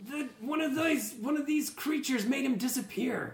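high-pitched urgent call; yelling back. Acted. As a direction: call out an explanation to the group. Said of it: "and she yells back".

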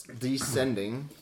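usual speaking voice

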